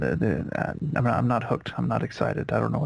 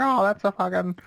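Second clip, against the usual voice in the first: boat expert voice; 9 semitones higher than usual. Retold or imitated. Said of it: "would look at this boat and be like".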